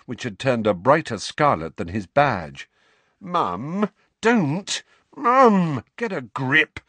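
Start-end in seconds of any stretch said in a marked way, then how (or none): none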